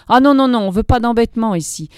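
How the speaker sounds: indignant woman and begging man; indignant refusal. A narrator's reading. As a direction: voice the quick refusal scene. Woman refuses, man offers money.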